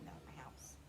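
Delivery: whispered aside. Real, not acted. Aside to the listener about her house, whispered. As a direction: trail off into a whisper.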